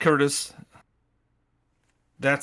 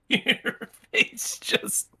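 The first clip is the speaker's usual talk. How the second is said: laughing; 9 semitones higher, much slower than usual. Real, not acted. Laughing at pirate face.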